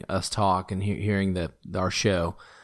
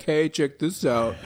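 mock neighbor boast; 6 semitones higher, slower than usual. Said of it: "neighbors to be like".

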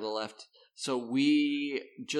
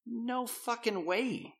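slow, high, softer disbelief; incredulous refusal; 9 semitones higher, 6 dB quieter, slower than usual. Acted. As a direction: draw out a higher-pitched flat-out refusal. Acting out refusal to airline staff.

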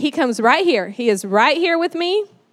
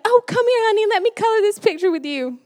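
high-pitched, quick sweet mama coaxing; suddenly sweet, lilting high and quick; 9 semitones higher, faster than usual. Retold or imitated. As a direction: coax a child in a sugary mommy voice. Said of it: "did your mama do that".

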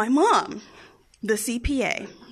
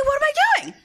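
imitated high-pitched shrieking question; mother's frantic demand; 4 dB louder, much faster than usual. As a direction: shriek it like a mom losing it. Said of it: "she's still asking me".

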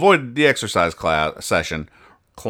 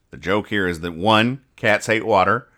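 normal talking voice

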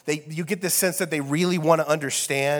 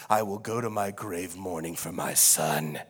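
mournful father; 10 semitones lower, 5 dB quieter than usual. Acted. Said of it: "and then weep uncontrollably".

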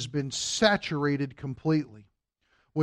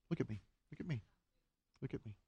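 softer each time, down to a hush; soft coaxing; 2 semitones lower, 17 dB quieter, much faster than usual. Real, not acted. Father hushing to win his shy son's attention, fading softer.

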